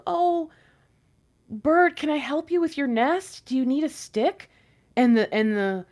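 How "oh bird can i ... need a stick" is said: lightly voiced eager helper, a bit higher; sweet, trusting offer of help; naive helper. Nice little person offering help to the bird, lightly higher and eager. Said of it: "this nice little person comes along and he's like".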